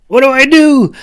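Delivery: very high, loud panic; terrified shout. A narrator's reading. Clove on the bucking unicorn, terrified shout. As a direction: scream it in terror. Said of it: "shouted in terror".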